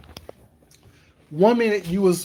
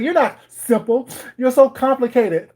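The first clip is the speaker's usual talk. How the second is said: high, louder imitation of the girl; mocking the girl; 7 semitones higher, 4 dB louder than usual. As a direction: play the accusing girl, high. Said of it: "She's like".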